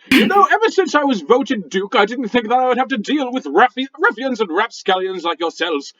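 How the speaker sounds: huffy posh British rock voice; indignant British gentleman scolding; a rock with a stuffy British accent; angry British. Acted. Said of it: "The Rock says".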